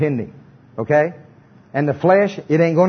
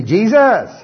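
call-and-response confession; 3 semitones higher, slower than usual. Acted. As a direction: proclaim each phrase boldly, line by line. Leads congregation in confession.

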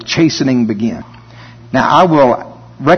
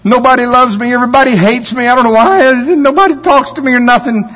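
high, loud whining complaint; mock whiny self-pity; 10 semitones higher, 4 dB louder, faster than usual. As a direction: whine like a sorry-for-yourself believer. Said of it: "You'll say something real spiritual".

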